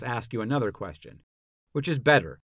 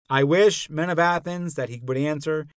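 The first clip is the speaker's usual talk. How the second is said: raised, higher-pitched plea to the jury; exasperated appeal; 5 semitones higher, 4 dB louder than usual. A narrator's reading. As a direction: call on the listeners, mildly exasperated. Socrates appeals to jury over interruptions.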